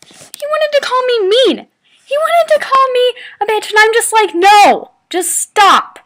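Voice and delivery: high, incredulous rant building to loud; high-pitched outrage, ending in a forceful protest; indignant outburst. Real, not acted. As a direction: get worked up, then loudly refuse. Indignant at being called names.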